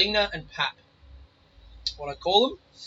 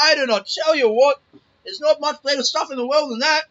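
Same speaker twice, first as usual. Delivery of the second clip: hearty boast; 10 semitones higher, 8 dB louder than usual. Retold or imitated. Grandpa boasting about his stout.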